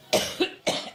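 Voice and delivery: harsh repeated coughs; coughing fit. Real, not acted. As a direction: cough hard, several times. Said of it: "every time I think about Trump, I get allergic".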